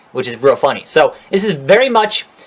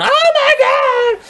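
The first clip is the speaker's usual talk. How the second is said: much higher, slow mock panicked shriek; much slower than usual. Steph shrieking as Roman pulls Triple H from the car, in mock panic. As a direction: ham up a terrified squeal. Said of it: "Steph's going".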